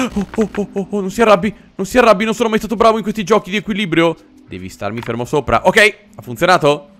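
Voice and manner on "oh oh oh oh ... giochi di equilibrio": high, gasping mock panic; panicked apology. Player apologizing to an angry creature, in mock panic. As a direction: beg the creature not to get angry.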